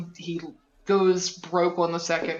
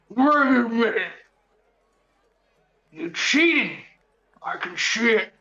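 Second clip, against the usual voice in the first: put-on orc voice, opening higher and louder; accusing half-orc; 4 semitones higher, 3 dB louder than usual. Orc grabs the cheater's wrist, in an accusing voice.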